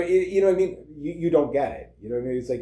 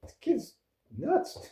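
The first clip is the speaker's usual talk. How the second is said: mock amused adult; 8 semitones higher, 5 dB quieter, slower than usual. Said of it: "And you're like".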